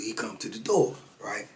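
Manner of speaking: ordinary talk, nothing marked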